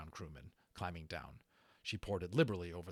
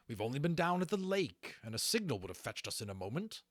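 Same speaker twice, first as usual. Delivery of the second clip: firm, raised protest; sharp; 7 semitones higher, 4 dB louder than usual. A narrator's reading. Lawrence defending the cadets, sharp and firm. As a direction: push back sharply. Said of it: "speaking sharply".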